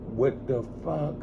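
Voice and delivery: quiet, slow, bewildered; stunned what the fuck. Retold or imitated. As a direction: murmur it in disbelief. Stunned reaction in dream.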